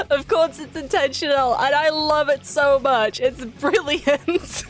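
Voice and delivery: giggling. Real, not acted. Giggling at the cheeky character placement, laughing. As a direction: giggle through every word. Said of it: "Keep giggling at it".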